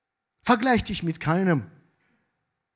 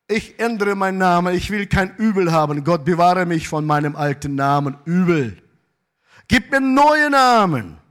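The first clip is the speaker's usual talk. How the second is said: emphatic plea; 2 semitones higher, 6 dB louder than usual. Acted. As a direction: declaim it loudly, building to a raised cry. Acted prayer rejecting his old name.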